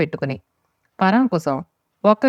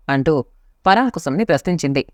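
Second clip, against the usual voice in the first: plain reading voice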